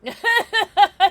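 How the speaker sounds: high burst of laughter; loud laugh. Real, not acted. Laughs at chat comment.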